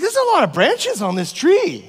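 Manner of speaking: high, loud overwhelmed cry about all the branches; sudden dismay at how much is left; exasperated realization. Retold or imitated. Retelling the moment up the tree, exasperated and high.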